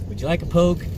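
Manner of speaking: Northern Irish offer. Shown as a demonstration. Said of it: "I'm having to put it on a wee bit".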